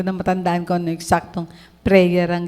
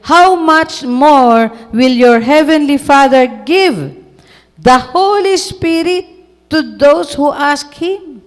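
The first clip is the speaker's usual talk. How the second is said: slow, loud, raised preaching voice; preaching scripture; 4 semitones higher, 5 dB louder, much slower than usual. Acted. Preacher proclaiming the verse to the congregation, slow and loud. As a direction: stretch each word of the verse like a pulpit climax.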